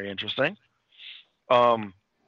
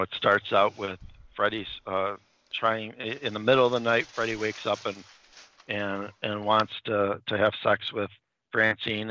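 normal talking voice

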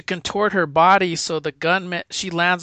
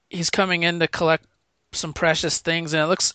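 ordinary delivery

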